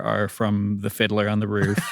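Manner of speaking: normal talking voice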